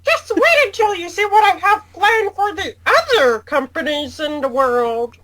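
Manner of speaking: high cartoon mouse making threats; Mickey Mouse. Cartoon mouse threatens other companies, in Mickey Mouse voice. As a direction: play a scheming, high-pitched cartoon mouse. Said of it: "that Mickey Mouse impression".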